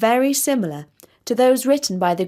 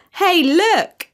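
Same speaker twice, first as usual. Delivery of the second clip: much higher, louder jeering goose call; higher, louder goose shouting hey look; mocking goose; 9 semitones higher, 6 dB louder than usual. A narrator's reading. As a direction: call out to the flock, amused. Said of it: "said one".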